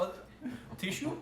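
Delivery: unsure merchant checking the wrap; lightly voiced dwarf. Dwarf voice, hesitant.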